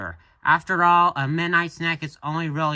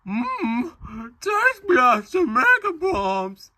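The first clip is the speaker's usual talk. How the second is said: put-on drawn-out high falsetto girl; slow, loud, badly faked girl voice; Yumi; 11 semitones higher, 4 dB louder, slower than usual. Reader playing Yumi admiring cereal, squeaky girl voice. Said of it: "What's with that voice?".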